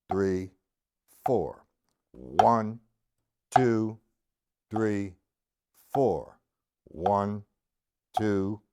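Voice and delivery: low, evenly spaced counting, one word per beat; slow quarter-note count. Shown as a demonstration. Drum teacher demonstrating line one, slow measured count. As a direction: count slowly and steadily in time, leaving space after each number. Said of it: "with line one in quarter notes".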